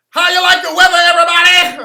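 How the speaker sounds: high, very loud shouted hype-man voice; screaming crispy character voice; crispy voice yell. Retold or imitated. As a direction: bellow it like a hyped-up character who won't quit. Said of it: "yelling in the crispy voice".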